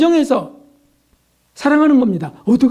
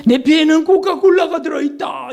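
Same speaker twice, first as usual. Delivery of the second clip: boastful shout; 6 semitones higher than usual. Retold or imitated. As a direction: crow it out like a proud company boss. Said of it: "이렇게 자랑했던 것처럼". (코카콜라 사장이 자랑하듯 높게 외치는 소리)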